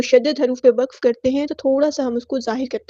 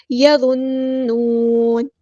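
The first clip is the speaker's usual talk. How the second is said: یظنون with the doubled n hummed long; timed ghunnah; much slower than usual. Shown as a demonstration. Teacher models stopping, long nasal n in یظنون. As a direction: hold both n sounds in یظنون, slowly. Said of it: "غنہ زمانی ہو رہا ہے تو time دینا ہے".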